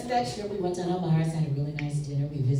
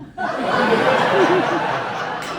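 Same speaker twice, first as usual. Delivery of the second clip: big loud laugh, high; loud burst of laughter; 8 dB louder than usual. Real, not acted. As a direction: howl with laughter at the reveal. Uncle reveals grandfather's habit.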